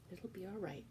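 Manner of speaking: soft, quiet reassurance; calm her gently; soothing. Acted. Louise comforting crying Cook, soft.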